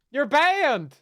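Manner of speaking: loud joking ban; playful shout. Pretend. Mock banning the donor.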